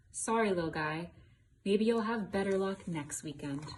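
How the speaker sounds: storybook reading. A narrator's reading. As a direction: read it out to the little ones. Child reads the story aloud.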